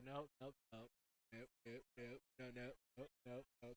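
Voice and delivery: low, soft string of nopes; hushed, sinking letdown; deflated muttering. Real, not acted. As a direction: drop right down and mutter it away. Play fizzles, commentator mutters repeated nopes.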